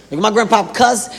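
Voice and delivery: ordinary talk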